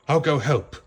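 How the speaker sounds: a hint of a firm father dog; father dog resolving to help his pup; Pongo's voice. Pongo answers.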